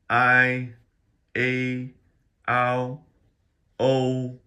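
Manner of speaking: high, level, held vowels; each vowel sustained on one high pitch; first-tone finals. Shown as a demonstration. Teacher reading out the finals one by one, high flat tone. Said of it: "let's just rip through these in the first tone".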